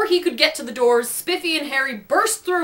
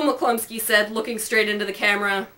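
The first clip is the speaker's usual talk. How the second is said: ordinary delivery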